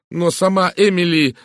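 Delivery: high, loud exclamation cut short; broken-off outburst. A narrator's reading. Miss Howard nearly says too much, outburst broken off. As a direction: blurt it loudly, then stop dead. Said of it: "Она оборвала фразу".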